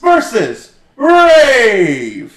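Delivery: booming ring announcer, high and very loud, stretched slow. Acted. Co-host jumping in to announce the show segment.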